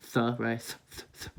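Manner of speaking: "th" breathed, tongue between lips; TH sound. Shown as a demonstration. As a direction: push air over the tongue for "th". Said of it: "saying the T H sound".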